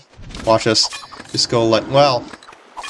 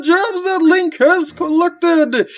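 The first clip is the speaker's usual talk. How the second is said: wrestling announcer; 11 semitones higher than usual. Pretend. Reads game spirit dialogue again.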